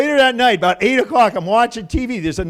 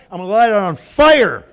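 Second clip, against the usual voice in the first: loud, punchy threat; worked-up, raised voice; exasperated threat; 3 semitones higher, 7 dB louder than usual. Retold or imitated. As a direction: hit it hard, exasperated.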